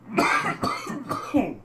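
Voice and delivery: dry hacking cough; harsh coughing fit. Real, not acted. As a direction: cough hard, throat burning. Choking on pepper steam.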